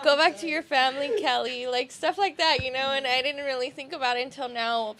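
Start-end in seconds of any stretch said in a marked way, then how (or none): 0.0-1.7 laughing mock tease. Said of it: "they'd be like".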